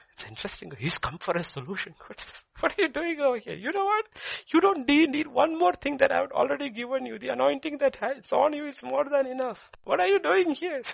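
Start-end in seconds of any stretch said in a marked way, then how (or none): 2.1-10.8 very high, loud rebuke; exasperated scolding. Acted. God to Elijah who came for a solution, exasperated scolding. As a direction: scold in a raised, high-pitched voice.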